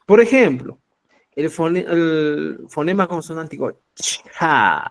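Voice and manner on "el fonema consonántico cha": "cha" pushed out with breath; aspirated sound demo. Shown as a demonstration. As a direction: blow air on "cha". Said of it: "decimos, ¿eh?".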